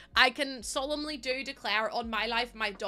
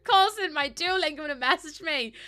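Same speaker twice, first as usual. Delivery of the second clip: teasing scold; 8 semitones higher, 10 dB louder than usual. Pretend. Teasing a chatter.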